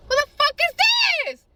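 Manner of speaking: high, loud acted yell; outraged demand. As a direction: demand it in furious disbelief. Retold angry phone confrontation.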